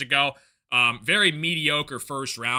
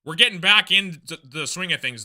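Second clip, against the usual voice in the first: ordinary delivery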